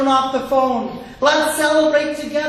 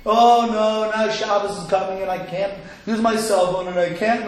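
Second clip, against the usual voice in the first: droning, lower mock lament; burdened complaint; 3 semitones lower than usual. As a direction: groan it out like a weary chore. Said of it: "If it's a burden".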